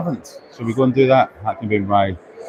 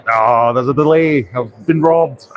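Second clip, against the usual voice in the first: mock outrage; 3 semitones higher, 4 dB louder, slower than usual. Player who just lost a stall game, mock outrage. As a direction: cry foul in jest, stretched out and raised.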